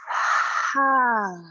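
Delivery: big voiced open-mouth sigh, falling in pitch; demonstrative exhaling sigh. Shown as a demonstration. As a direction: let out a full "haa" through the mouth. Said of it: "Big sigh out the mouth".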